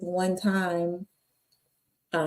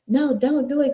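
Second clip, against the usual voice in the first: higher-pitched mother begging him to stop; pleading mama; 7 semitones higher than usual. Retold or imitated.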